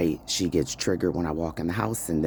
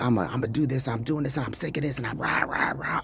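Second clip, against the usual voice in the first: higher, fast mock rant; retold rage; 8 semitones higher, faster than usual. Retelling her own enraged rant that night, fast and high. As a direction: rattle off her own angry ranting, half-mocking.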